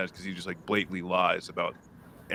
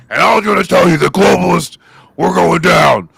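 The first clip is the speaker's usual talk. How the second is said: roaring drill sergeant rant; loud gung-ho soldier; 8 semitones higher, 14 dB louder than usual. Retold or imitated. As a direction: bellow it like a Marine drill instructor. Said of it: "the Marine Corps voice".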